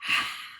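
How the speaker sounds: long adoring sigh; Barry sighing in admiration; dreamy sigh. Acted. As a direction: let out a lovestruck sigh. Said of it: "sighed Barry".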